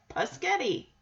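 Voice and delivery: "paschetti", first sounds swapped, starting pas-; "paschetti" with pas- in place of spa-; insisting on the mispronunciation. Retold or imitated. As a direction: repeat "paschetti" as a child sure it's correct. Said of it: "No, Michelle, that's spaghetti".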